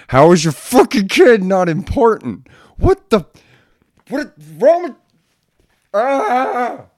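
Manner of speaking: high, loud exasperated rant; furious high-pitched disbelief; angry outburst. Real, not acted. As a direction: blow up at them in disbelief. Ranting at careless parents.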